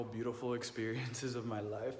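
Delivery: normal talking voice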